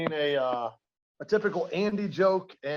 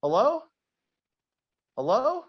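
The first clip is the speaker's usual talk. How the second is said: high-pitched calling out, moderately raised; mock yell; 9 semitones higher, 4 dB louder than usual. Man calls into the night for the stranger, mock yelling. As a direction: call out as if searching, half-yelled. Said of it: "he starts yelling".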